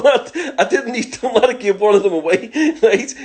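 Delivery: words broken by laughter; high, loud giggling telling; laughing. Real, not acted. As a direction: laugh through the punchline. Laughing while telling burger story.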